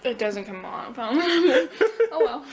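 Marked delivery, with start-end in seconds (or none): 1.0-2.1 burst of laughter. Real, not acted. Laughs after gadget fails.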